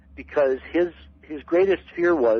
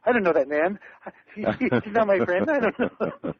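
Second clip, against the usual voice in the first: higher-pitched, laughing mock denial; amused, high-pitched retold protest; mock disowning; 8 semitones higher than usual. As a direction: disown him jokingly through laughter. Jokingly disowning his friend, amid laughter.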